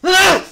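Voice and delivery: short, high, loud yell; excited yell. Real, not acted. Streamer overwhelmed by a favorite character, excited yell. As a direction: burst out with a fan's yell.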